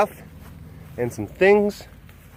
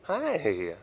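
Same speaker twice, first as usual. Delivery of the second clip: ordinary talk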